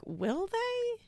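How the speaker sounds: high, mocking "will they?"; sarcastic retort. A skeptical viewer pushing back on Reddit commenters, in a high mocking tone. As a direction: scoff at the commenters with a high doubtful question.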